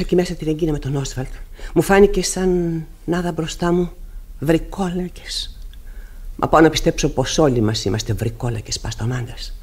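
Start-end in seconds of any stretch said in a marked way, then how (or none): 4.4-5.6 slow breathy whisper on the word. Acted. Mrs Alving whispering of ghosts to the pastor, slow whisper.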